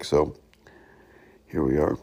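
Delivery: ordinary talk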